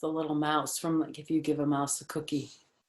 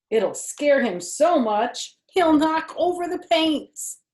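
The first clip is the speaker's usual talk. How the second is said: higher, louder storybook voice; reading to children; 12 semitones higher, 9 dB louder, slower than usual. Acted. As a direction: read it brightly to a little listener. Reading picture book aloud.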